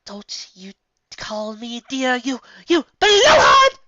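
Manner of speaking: slow build to a loud, high scream; shouted. A narrator's reading. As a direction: explode on blowhard. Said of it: "Rarity shouted".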